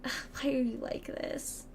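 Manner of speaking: slow, breathy mock despair; defeated whisper. Streamer despairing at a viewer, in a defeated whisper.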